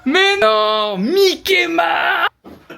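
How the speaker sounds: yelling across the room. Real, not acted. Calling friend over.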